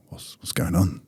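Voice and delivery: hushed, breathy murmur of a confused room; whisper. Retold or imitated. Players confused in the dressing room, whispering. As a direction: whisper it like teammates muttering to each other. Room reacting to his dismissal.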